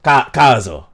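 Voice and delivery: usual speaking voice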